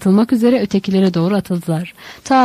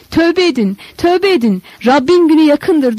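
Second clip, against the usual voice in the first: çok yüksek, çağıran haykırış; çölde halkı tövbeye çağır; bağırarak; 8 semitones higher, 4 dB louder than usual. A narrator's reading. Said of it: "diye bağırıyordu". (Yahya çölde halkı tövbeye çağırıp haykırıyor)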